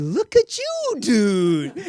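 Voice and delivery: high, loud, amazed "Look at you, dude"; big delighted shout of admiration; hammed-up celebrity amazement; impressed exclamation. Kobe exclaims at hearing he's a doctor.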